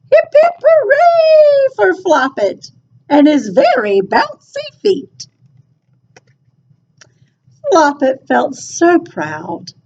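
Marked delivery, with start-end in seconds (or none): 0.1-5.3 higher, lightly bleating lamb voices. A narrator's reading. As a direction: cheer like little lambs. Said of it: "The lambs began to bleat".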